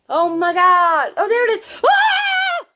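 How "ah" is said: sudden high shriek of fright; startled scream. Real, not acted. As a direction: shriek in surprise. Bird suddenly appears.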